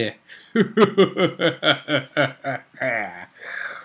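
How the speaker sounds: long theatrical villain cackle; evil laugh. Acted. Host signs off from the crypt, evil laughing. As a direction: cackle on and on, falling at the end. Spooky host's sign-off.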